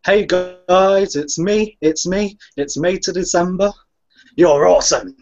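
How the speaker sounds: high-pitched mock vlogger greeting; Malcolm. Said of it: "I'll do Malcolm first".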